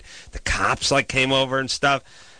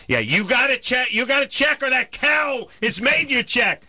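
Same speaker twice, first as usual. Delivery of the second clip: higher, heated jeer; mock angry retort; 10 semitones higher than usual. Yelling down at the neighbor and the DWP guy, heated. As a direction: throw it back at her with scorn.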